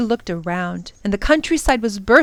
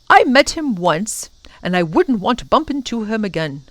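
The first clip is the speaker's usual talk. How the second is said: animated professor, raised and emphatic; cautioning professor; 3 semitones higher, 3 dB louder than usual. Acted. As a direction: warn the children firmly, easing off at the end. Professor warns the children.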